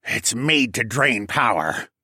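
slow, villainous penguin declaration; penguin declaring. A narrator's reading. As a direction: declare it darkly, drawn out. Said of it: "the penguin declared".

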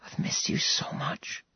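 soft unvoiced whisper; breathed whisper. Serafina whispering to Max in his arms. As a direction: breathe it to him, no voice. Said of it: "she breathed".

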